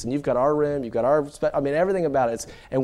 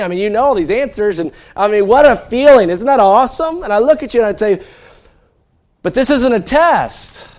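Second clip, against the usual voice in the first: loud, high preaching push; impassioned sermon delivery; fervent preaching; 9 semitones higher, 10 dB louder than usual. Acted. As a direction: drive the point home to the congregation. Preaching build to climax.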